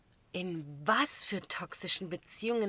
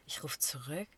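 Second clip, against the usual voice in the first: ordinary talk, nothing marked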